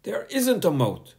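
high, firm schoolteacher voice; a strict teacher scolding a pupil; stern teacher. A narrator's reading. Teacher warning Bertie to sit down, stern and high. Miss Boot's voice.

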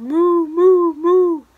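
cow's moo. Acted. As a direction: moo boldly like a cow. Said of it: "the duck on the pond said".

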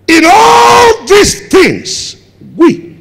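high, loud, slow drawn-out refrain; preaching shout. Real, not acted. Preacher repeating the verse to the church, slow ringing shout. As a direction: hold each word long and ring it out. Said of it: "I wish I could preach a little bit".

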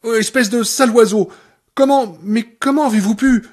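loud, high, outraged; furious outburst. Acted. Annie furious at Paul over Misery's death. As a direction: hurl the accusation at him.

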